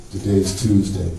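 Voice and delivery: low flat casual answer; deadpan husband. Retold or imitated. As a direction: toss it off low and bored.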